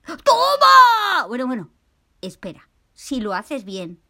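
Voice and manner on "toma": much higher, loud shout of joy; excited cheer. Acted. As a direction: yell it like you've won a prize. Paco celebra la tarta. (grito de alegría, agudo y fuerte)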